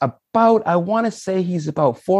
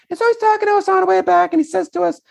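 very high, quick second-grader voice; high-pitched kid voice; faster than usual. Retold or imitated. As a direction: narrate in a squeaky falsetto. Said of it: "This kid had a high voice too".

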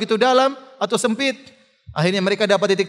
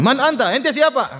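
challenging question; 6 semitones higher than usual. Retold or imitated. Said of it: "Kemudian Amr bertanya".

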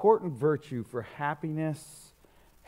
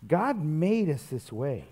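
ordinary talk

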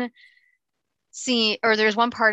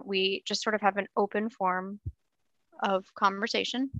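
normal talking voice

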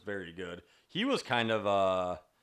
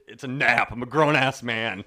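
mock indignant protest; 10 dB louder than usual. Husband retorting to his wife's baby talk, mock indignant. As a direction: object loudly to being babied, half joking.

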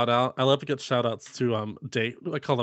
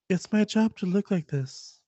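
high breathy mock Marilyn; imitating Marilyn line; 6 semitones higher than usual. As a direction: parody the bland reading. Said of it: "Just heard, like".

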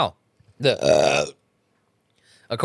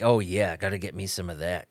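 low, much softer come-hither purr of a lady cat; sultry female cat; 8 semitones lower, 9 dB quieter than usual. Retold or imitated. Female cat answers the crooning tomcat, low and sultry. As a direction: lean out the window and coo it low. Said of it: "the female cat is leaning out her window like".

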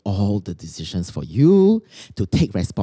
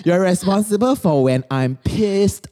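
higher, slow, sugary pleading voice; clingy girlfriend; 4 semitones higher, slower than usual. Acted. Girlfriend whining to her boyfriend that he must make her happy, syrupy. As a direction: coo at the boyfriend, drawing each word out.

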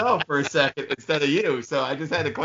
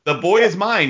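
mock villain declaration; 5 semitones higher, 4 dB louder than usual. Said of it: "he said".

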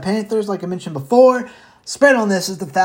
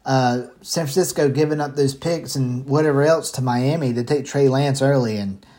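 normal talking voice

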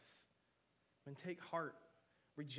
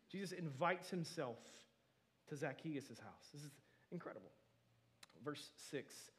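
ordinary talk

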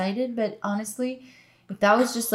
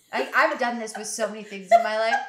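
ordinary talk

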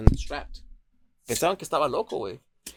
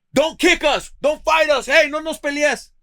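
much higher and louder commands; shouted police orders; 13 semitones higher, 8 dB louder, slower than usual. Retold or imitated. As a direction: bark orders at a handcuffed man. Police officers barking orders. (policías gritando órdenes, mucho más fuerte y agudo)

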